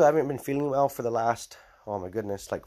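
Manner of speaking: ordinary talk, nothing marked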